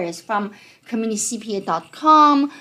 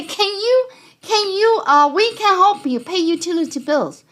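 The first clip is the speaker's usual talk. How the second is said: high, eager phone-scammer voice, pitched up; 6 semitones higher than usual. Retold or imitated. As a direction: play a pushy caller offering to pay bills. Scammer call acted out.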